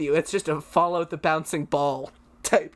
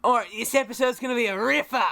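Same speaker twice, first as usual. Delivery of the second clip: fast, higher Aussie host patter; Australian accent; 4 semitones higher, faster than usual. Acted. Guest restarts the show with new slang, Australian accent. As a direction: announce it like an Australian presenter. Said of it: "introduce me as Plastic E P".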